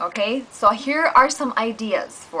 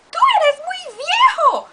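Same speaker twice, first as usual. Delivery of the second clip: much higher, louder cat; squeaky cat; 5 dB louder than usual. Acted. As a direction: squeal it back. Cartoon fight continues.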